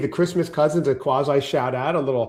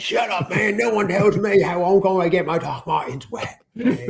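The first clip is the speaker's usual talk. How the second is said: higher-pitched put-on Texas accent, badly faked; hammed-up tough guy with a shaky Texan accent; dubious Texas accent; 4 semitones higher than usual. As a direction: play a defiant Texan, accent unconvincing. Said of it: "you sure that's an accent from Texas".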